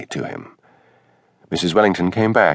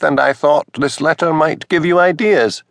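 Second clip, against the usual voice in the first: higher, flustered minister's wife; rising higher as she gets flustered; 8 semitones higher than usual. A narrator's reading. Mrs Wellington accuses Hamish.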